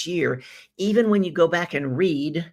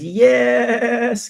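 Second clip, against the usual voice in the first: excited yes; 9 semitones higher, 8 dB louder than usual. Retold or imitated. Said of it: "Sheila says".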